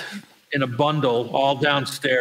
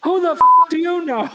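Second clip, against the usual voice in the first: loud, high-pitched disbelief; high mock-outraged demand; acted incredulous shout; 8 dB louder than usual. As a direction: shout it as astonished sisters. Sisters' astonished reaction acted out.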